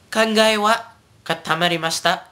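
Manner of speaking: katamarimashita run together quickly; said fast. Shown as a demonstration. Teacher gives a Japanese example phrase, said fast. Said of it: "it's really, really fast".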